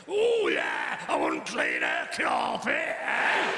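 camp Cockney Kenneth Williams impression, shouted with a swooping drop; hammed-up Cockney Kenneth Williams voice; over-the-top camp Cockney shout; bad Cockney Kenneth Williams. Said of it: "really bad Cockney version of Kenneth Williams".

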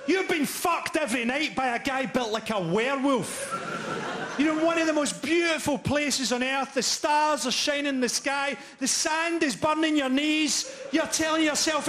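hammed-up heroic rapture; mock epic. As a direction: sell it like a grand film voiceover. Mock-epic romantic Afghanistan scene.

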